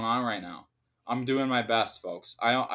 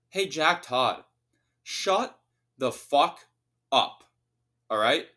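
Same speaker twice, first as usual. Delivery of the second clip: angry shout; 3 semitones higher, 4 dB louder, slower than usual. Real, not acted. Ranting at the columnist.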